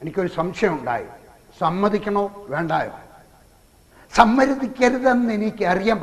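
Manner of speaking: ordinary talk, nothing marked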